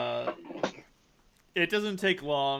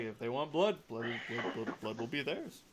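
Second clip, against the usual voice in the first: ominous Palgor; 2 semitones lower, 5 dB quieter than usual. Acted. Palgor grants the duel.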